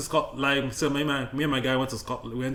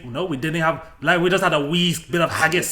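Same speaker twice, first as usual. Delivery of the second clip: put-on Scottish accent; 3 semitones higher, 10 dB louder than usual. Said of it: "please do not flame us in the comments for that accent".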